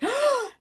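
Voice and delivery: mock gasp. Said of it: "someone's gonna be like".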